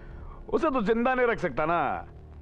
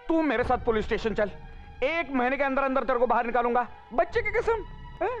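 high-pitched pleading promise; desperate bargaining; 7 semitones higher than usual. Acted. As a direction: swear it, voice climbing higher. Cornered man bargains for his life.

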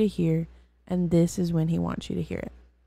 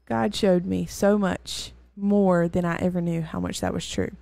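usual speaking voice

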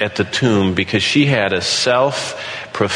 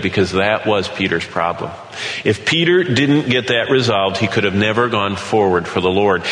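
usual speaking voice

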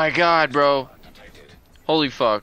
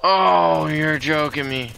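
ordinary delivery

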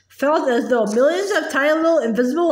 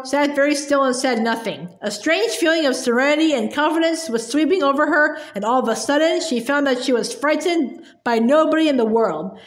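ordinary delivery, nothing marked

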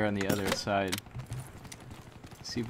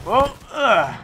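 frustrated groan; 12 semitones higher, 11 dB louder than usual. Real, not acted. Killed in match, frustrated.

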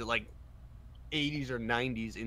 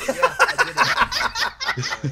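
high, slow, loud gloating; smug triumph; 12 dB louder, much slower than usual. Pretend. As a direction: gloat slowly at the top of your voice. Acted triumphant quote.

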